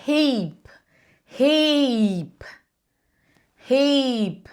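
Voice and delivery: "heap" with a long, stretched EE and falling tune; lengthened vowel; long I demo. Shown as a demonstration. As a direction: hold the EE in "heap". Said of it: "É alongado desse jeito mesmo".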